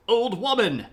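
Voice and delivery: loud, high regal shout; King Arthur calling out. Retold or imitated. King Arthur calling to a peasant, loud and high. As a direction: hail the peasant like a haughty king. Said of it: "he rides up on his horse and he goes".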